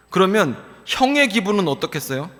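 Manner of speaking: usual speaking voice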